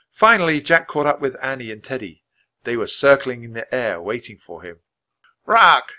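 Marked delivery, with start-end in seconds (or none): 5.4-5.9 loud, high croaking call; raven croak. Acted. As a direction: caw it out like a raven. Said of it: "Jack croaked".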